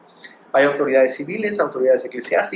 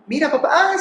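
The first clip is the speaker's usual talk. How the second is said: put-on eager child; 11 semitones higher than usual. Child asking father for attention.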